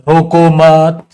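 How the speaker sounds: "रोको मत" drawn out loud and slow as one unit, then a stop; pause after मत. Shown as a demonstration. Teacher showing how a comma changes meaning, slow phrase halted after "मत". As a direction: stretch "रोको मत" together and halt after "मत". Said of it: "रुकने के लिए ही तो comma होता है".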